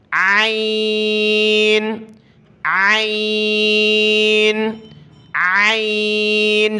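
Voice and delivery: very slow ain pushed from the throat; عین stretched from the throat; throaty ain. Shown as a demonstration. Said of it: "زور لگا کر گلا صاف کرتے ہوئے اس طرح".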